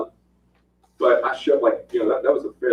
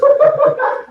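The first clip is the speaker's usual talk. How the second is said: high, loud burst of laughter; 6 dB louder than usual. Real, not acted. Laughing at the dazed fighter's question, a high burst of laughter. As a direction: crack up at the memory. Laughs at his own story.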